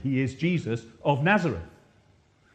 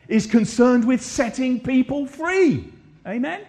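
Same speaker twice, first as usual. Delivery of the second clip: loud, high impassioned preaching; preacher driving the point home, loud and high; 6 semitones higher, 5 dB louder than usual. Acted. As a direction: build the sermon to full force. Preaching at full force.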